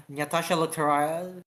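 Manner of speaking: ordinary delivery, nothing marked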